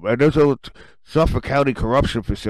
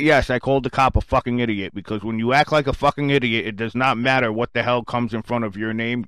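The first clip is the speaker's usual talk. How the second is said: ordinary talk, nothing marked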